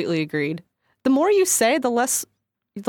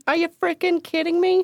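high mock disbelief; a joking echo of an outraged host; mock outrage; 8 semitones higher than usual. Echoing a host offended by a refused dessert, mock outrage.

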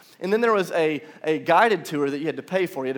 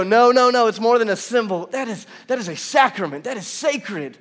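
higher and much louder insisting it is sacred; emphatic believer; 6 semitones higher, 6 dB louder than usual. Pretend. Pastor voicing devout churchgoers to the congregation, emphatic. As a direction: passionate protest. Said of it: "some of you that go".

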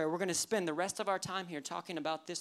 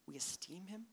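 puzzled; 14 dB quieter than usual. Acted. Puzzles over an odd word.

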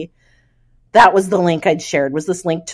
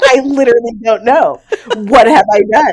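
real laughter breaking through, voice high and louder; laughing panic; 4 semitones higher, 5 dB louder than usual. Real, not acted. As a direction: laugh helplessly through the question. Panicked retelling of the email disaster.

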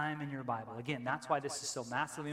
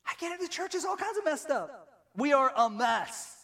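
much louder and higher, preaching at full force; impassioned preaching; 10 semitones higher, 5 dB louder than usual. Real, not acted. Preacher to church on God's bride, impassioned and loud. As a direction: build to a ringing climax.

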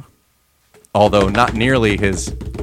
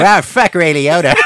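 high, loud disgusted snark; bitchy Corey impression; 6 semitones higher, 4 dB louder than usual. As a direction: sneer it like a grumpy friend. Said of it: "it evolved into".

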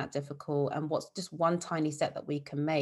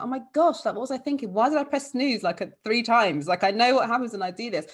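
higher, louder exasperation at yourself; mock self-scolding; 6 semitones higher, 5 dB louder than usual. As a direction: scold yourself for oversleeping, with a laugh at the end. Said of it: "you are gonna be like".

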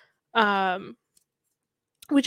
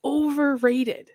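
slow emphatic drawl; 4 semitones higher, 6 dB louder, much slower than usual. Real, not acted. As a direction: stretch out so overrated slowly. Drawn-out emphatic complaint.

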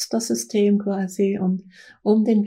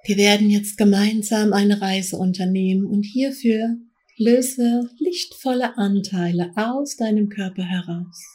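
guided meditation. Acted. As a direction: guide them gently into trance. Leads a guided trance journey.

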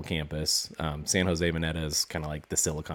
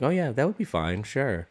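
playing it cool; 7 semitones higher than usual. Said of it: "I tried to play it cool".

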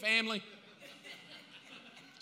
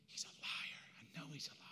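whispered, much softer, bitter; 4 semitones lower, 16 dB quieter than usual. Acted. Eve whispering to herself that God lied, bitter. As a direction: hiss it to yourself in betrayal. Eve's bitter private thought.

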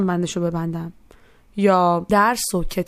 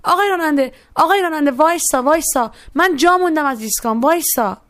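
raised, high-pitched urgent call to the driver; 6 semitones higher, 4 dB louder than usual. A narrator's reading. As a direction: shout for the bus to stop. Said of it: "داد زد". (فریاد زدن با صدای زیر)

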